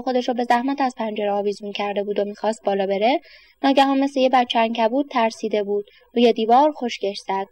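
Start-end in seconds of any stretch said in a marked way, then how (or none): none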